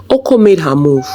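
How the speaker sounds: plain reading voice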